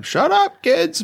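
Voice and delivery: high-pitched mock yell at the kids; jokey exasperated parent yell, breaking into laughter. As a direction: yell it playfully, voice jumping way up. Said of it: "like, yell at 'em".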